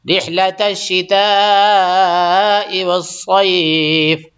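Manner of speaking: slow, drawn-out chanted tilawat; tajweed recitation; Quran recitation. Acted. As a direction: recite it melodically, stretching the long vowels. Recites Quran between explanations.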